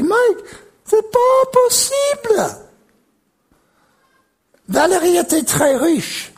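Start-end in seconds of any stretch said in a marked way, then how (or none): 0.0-2.6 high, drawn-out mock astonishment; acted incredulous cry, pitched way up; retold disbelief. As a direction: stretch it out high, acting stunned. Retold shock at seeing Mike.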